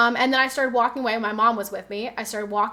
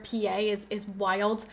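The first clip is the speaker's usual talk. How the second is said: normal talking voice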